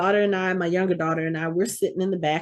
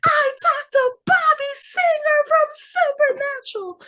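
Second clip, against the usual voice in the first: ecstatic post. Retold or imitated. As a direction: gush through happy tears. Daughter's ecstatic social media post.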